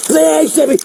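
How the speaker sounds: frantic begging; panicked plea. Real, not acted. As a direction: cry out in terror. Panicked man begging police.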